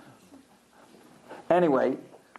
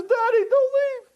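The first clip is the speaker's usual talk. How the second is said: put-on high, wailing child voice; acted tearful plea, pitched way up; pleading little boy. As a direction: imitate a small boy begging desperately. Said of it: "the little boy cries himself to sleep".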